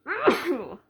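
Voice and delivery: sudden loud sneeze; real sneeze on camera. Real, not acted. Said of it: "I'm gonna sneeze".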